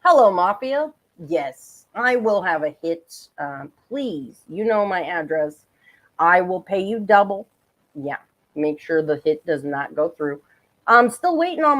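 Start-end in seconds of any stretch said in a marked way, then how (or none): 0.0-10.4 low, hushed mock call to the mob; pretend mafia phone call. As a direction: play it like ordering a hit on the phone.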